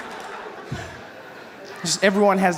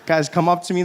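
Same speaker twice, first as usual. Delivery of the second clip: normal talking voice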